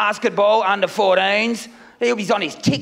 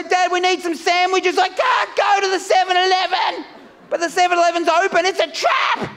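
much higher, shouting in mock panic; panicked squeal; 7 semitones higher than usual. Comic acting out a Melbourne curfew resident, panicking in a high shriek. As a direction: yelp it like you're terrified of police.

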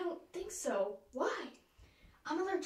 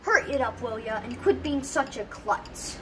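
impatient; 7 dB louder than usual. Acted. Bossing partner impatiently.

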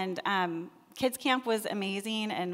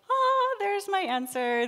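high, slow relieved "ah" of discovery; sudden realization; 11 semitones higher, 5 dB louder, slower than usual. Retold or imitated. Retelling to the church how a leader answered her questions, with a high, slow relieved realization. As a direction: let the answer land on you. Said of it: "and I was like".

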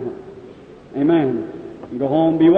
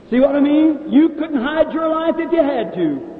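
higher, lifted with wonder; excited exclaim; 7 semitones higher than usual. Real, not acted. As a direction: marvel at what God reveals. Preacher exclaims to the crowd.

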